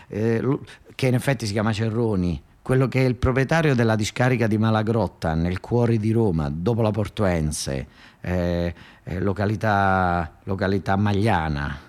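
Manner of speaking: ordinary talk, nothing marked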